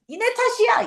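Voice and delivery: high-pitched mock accusation; blaming. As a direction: throw the blame at them, voice pitched high. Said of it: "이렇게 돌리는 거야".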